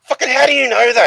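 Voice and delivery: high, loud aggrieved outburst; hammed-up incredulous shout; mock indignant booth guy. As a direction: play the annoyed taste-test man. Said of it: "And he goes".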